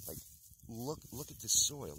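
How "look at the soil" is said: ordinary talk, nothing marked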